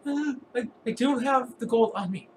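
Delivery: high, panicky stammering voice; cornered victim caving in, high-pitched; freaking-out dwarf. Acted. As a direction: plead frantically, tripping over the words. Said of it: "he's, like, freaking out".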